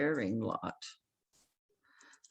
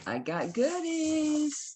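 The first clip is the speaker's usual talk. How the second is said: excited; 13 semitones higher, 4 dB louder, much slower than usual. Real, not acted. Showing off her new finds.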